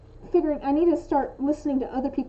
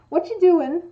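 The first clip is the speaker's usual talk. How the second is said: louder, higher demo of a curious question; interested; 4 semitones higher, 8 dB louder than usual. Shown as a demonstration. Showing someone interested in what you work on, interested. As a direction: run the words together with friendly curiosity. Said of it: "implies that someone is interested".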